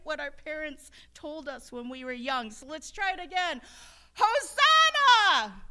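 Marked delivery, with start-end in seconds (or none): 4.1-5.6 very loud, high, slow and drawn-out shout; full shout. Shown as a demonstration. Preacher urging a louder church shout, full-throated shout. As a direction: let it ring through the church. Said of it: "let's try that again".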